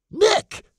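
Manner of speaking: high, loud alarmed shout of Nick. Acted. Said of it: "she shouted".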